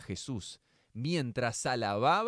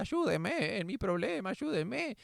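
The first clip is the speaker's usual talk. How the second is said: pleading widow; 9 semitones higher than usual. Retold or imitated. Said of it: "pero decía".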